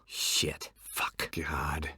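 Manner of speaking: breathless whispered swearing under the breath; whispered curses. Acted. Hero alone in the dark, swearing under his breath. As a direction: hiss the curses so nobody hears. Muttered curses after a fall.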